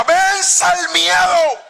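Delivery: high, slow battle cry; shouted slogan. Real, not acted. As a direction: cry it out over the crowd. Preacher's opening cry.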